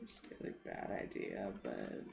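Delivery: soft unvoiced whisper to self. Real, not acted. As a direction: whisper it like a guilty aside. Muttering aside, unvoiced.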